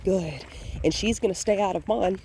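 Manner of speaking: ordinary delivery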